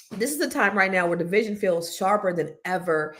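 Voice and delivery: normal talking voice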